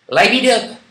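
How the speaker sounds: high, loud English with a French accent; showy English accent. Shown as a demonstration. Card reader showing off her English, loud French-accented English. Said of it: "mon accent est génial".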